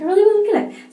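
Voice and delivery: high, loud, laughing gush of wanting; excited squeal. Retold or imitated. Retelling her wish for the shampoo, high laughing gush. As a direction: squeal it out, giggling with want. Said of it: "I was like".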